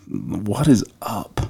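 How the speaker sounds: drawn-out, demanding; slow demanding question. Retold or imitated. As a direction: stretch it out slowly, demanding. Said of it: "he's like".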